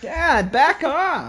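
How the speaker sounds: shrieking high yell. Pretend.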